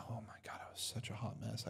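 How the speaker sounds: whispered aside, breath only; a sheepish whispered admission; embarrassed whisper. Real, not acted. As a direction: confess it under your breath.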